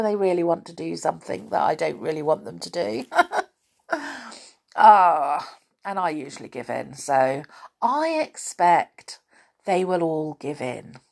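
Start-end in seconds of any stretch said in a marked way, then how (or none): none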